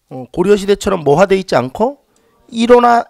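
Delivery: ordinary delivery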